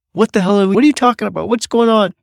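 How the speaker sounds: playing the baffled site supers at fifty-dollar cookies; incredulous crew.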